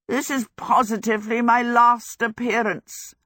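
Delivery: a sick man's feeble croak, hamming it; lightly voiced ailing wizard, croaky. A narrator's reading. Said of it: "Howl croaked".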